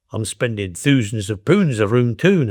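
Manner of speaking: mock Geordie with "oo" in pounds, town; demonstrated Geordie accent. Shown as a demonstration. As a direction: imitate a Geordie saying "poonds" and "toon". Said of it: "a Geordie would say".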